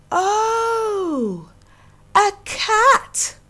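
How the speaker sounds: wide-eyed realization; surprised oh. Acted. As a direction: animals discovering the kitten, high and amazed. Animals realize it is a kitten.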